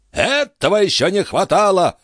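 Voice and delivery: high-pitched outraged exclamation; old baron's irate outburst; indignant cry. A narrator's reading. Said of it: "вскрикнул барон".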